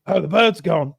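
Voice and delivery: mock blustering Englishman; Englishman discovering loss. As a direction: notice it, then demand the culprit. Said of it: "And he's like".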